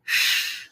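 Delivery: X as a voiceless sh hiss; just hiss a long sh. Shown as a demonstration. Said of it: "som de".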